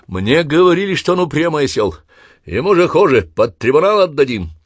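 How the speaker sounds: high, loud furious rant; angry outburst. Acted. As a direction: spit the insult out in rage. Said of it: "сорвался на матерную брань".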